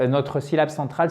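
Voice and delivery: ordinary talk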